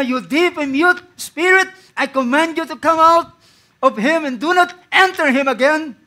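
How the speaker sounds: loud, high command to the spirit; preacher voicing Jesus casting out the spirit; commanding rebuke. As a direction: cast it out with authority.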